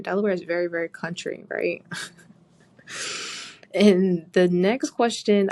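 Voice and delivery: ordinary delivery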